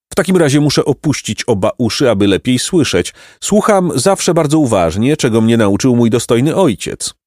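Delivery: pompous donkey philosopher. A narrator's reading. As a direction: declaim grievances as a misunderstood scholar.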